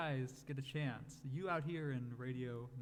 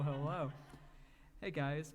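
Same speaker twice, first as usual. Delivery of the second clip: usual speaking voice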